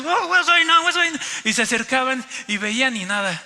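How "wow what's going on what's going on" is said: high, loud English chatter; imitating excited foreigners. Foreigners gathering again in the lobby, imitated excited cries. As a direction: mimic startled tourists crying out. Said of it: "otra vez todos los extranjeros".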